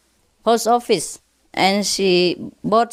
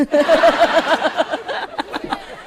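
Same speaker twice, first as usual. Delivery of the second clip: high bursting laugh at own joke; 8 semitones higher than usual. Real, not acted. Teller laughs at his own policeman joke, high. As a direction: laugh out at the punchline.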